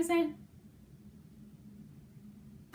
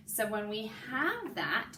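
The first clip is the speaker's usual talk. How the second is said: usual speaking voice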